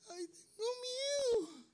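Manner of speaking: slow, very high mock whimper; voice of fear. Showing how one turns when scared, high whimpering voice. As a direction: stretch it out in a frightened falsetto. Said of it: "Uno se vuelve así como".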